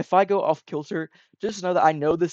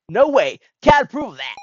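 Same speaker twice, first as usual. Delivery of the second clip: loud, raised-pitch objection; heated refusal; 7 semitones higher, 9 dB louder than usual. Acted. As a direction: refuse outright at full voice. Character hotly refuses.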